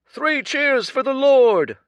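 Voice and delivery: high, slow ringing cheer; crowd cry. Acted. A voice from the crowd cheering the Lord, loud and high. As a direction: call it out over the crowd. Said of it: "cried a voice".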